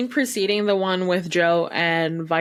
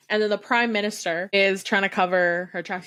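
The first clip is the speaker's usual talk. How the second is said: normal talking voice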